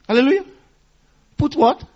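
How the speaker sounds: normal talking voice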